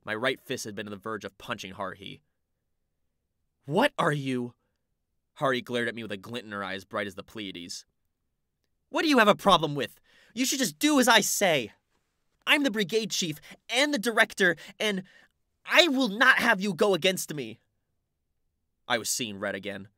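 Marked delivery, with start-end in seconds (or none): 3.6-4.5 angry. Acted. About to punch her. 8.9-17.6 put-on loud, high furious girl; angry shout. Haruhi glares and yells.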